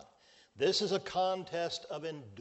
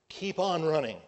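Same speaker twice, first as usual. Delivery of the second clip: on in Keep on running hit louder and held; stress on on; 3 semitones higher, 6 dB louder than usual. Shown as a demonstration. Preacher urging the flock to persevere, stress on on. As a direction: lean hard on on in Keep on running. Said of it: "is the idea".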